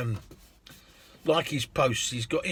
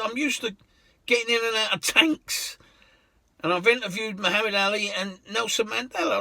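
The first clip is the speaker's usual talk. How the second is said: much higher woman's voice, proud; proud lady; 8 semitones higher than usual. Retold or imitated. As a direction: list her achievements. Lady voiced boasting of career.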